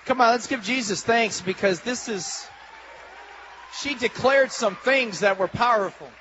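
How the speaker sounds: ordinary delivery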